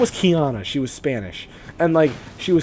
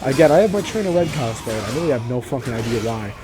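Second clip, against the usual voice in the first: usual speaking voice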